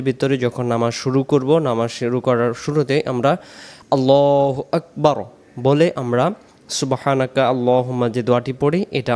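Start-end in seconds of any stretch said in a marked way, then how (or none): 3.9-5.2 prayer recitation voice; recited takbir. Shown as a demonstration. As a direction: intone it as in prayer. Teacher chanting takbir as in prayer.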